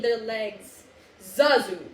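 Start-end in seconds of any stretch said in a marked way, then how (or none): 1.2-1.9 lower, louder command; a hint of a stern king; ordering. Mufasa ordering Zazu, lightly voiced. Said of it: "Mufasa ordered".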